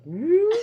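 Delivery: high, loud sliding whoop; cartoon bump noise. Player imitating a cartoon bump, high whoop. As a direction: imitate a cartoon lump popping up. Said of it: "a bump goes like".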